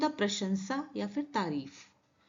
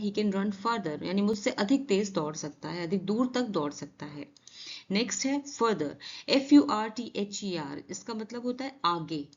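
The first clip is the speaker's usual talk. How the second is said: usual speaking voice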